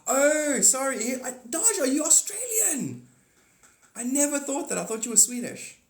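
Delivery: high, loud burst of surprise; real astonishment. Real, not acted. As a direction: exclaim in sheer disbelief at the news. Stunned to learn viewer is Australian.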